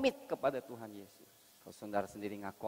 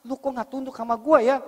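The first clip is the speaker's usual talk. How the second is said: much higher and louder angry demand; angry husband; 17 dB louder than usual. Acted. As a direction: bark it like an indignant husband. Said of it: "Meskipun dia marah".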